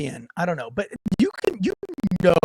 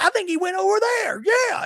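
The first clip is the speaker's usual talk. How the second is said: put-on drunk bystander; 13 semitones higher than usual. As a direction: ham up a sloppy drunk yelling at the cops. Drunk person pointing the way.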